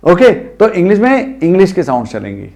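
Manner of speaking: ordinary delivery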